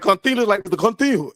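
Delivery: mock father making his son hold his eyes crossed; imitating stern dad. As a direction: order it like a strict dad. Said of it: "He like".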